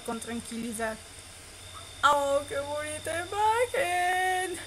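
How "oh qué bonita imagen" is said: high, slow, swooning gush; gushing squeal. Real, not acted. Player gushing at a romantic picture, high slow swoon.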